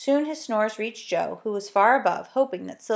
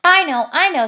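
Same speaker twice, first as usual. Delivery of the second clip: high, louder burst of delight; excited; 8 semitones higher, 9 dB louder than usual. A narrator's reading. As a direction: blurt it out as a sudden happy idea. Silky claps with sudden idea.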